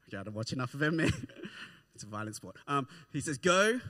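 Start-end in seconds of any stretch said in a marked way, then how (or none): 3.4-3.8 ref's call. Retold or imitated. Referee starting an MMA fight.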